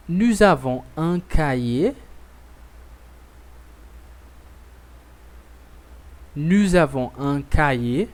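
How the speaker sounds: each phrase said twice, clear and deliberate with a rising end; slow repeat-after-me drill. Shown as a demonstration. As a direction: model the sentence slowly so the class can echo it.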